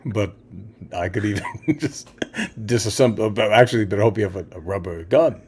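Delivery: very low, soft villain threat. Acted. Magneto warning someone with a gun, low soft voice. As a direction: improvise haltingly, deep and quiet. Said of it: "[name] as, as Magneto".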